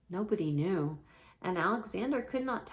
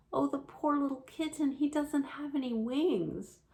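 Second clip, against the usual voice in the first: put-on higher, pitying little girl; girl's voice; 7 semitones higher than usual. Susan pitying the wingless kitten, higher. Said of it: "said Susan".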